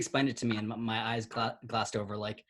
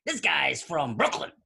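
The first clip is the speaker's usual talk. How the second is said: very loud, high, drawn-out Brooklyn guy; hammed-up New York shout; Brooklyn accent; 6 dB louder, much slower than usual. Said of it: "he kept saying".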